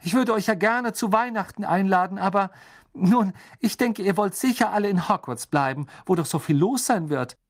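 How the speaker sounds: high-pitched motherly voice, cordial; motherly hostess. A narrator's reading. Misses Weasley answering the children's thanks, motherly and cordial. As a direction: play the fond mother in a high voice.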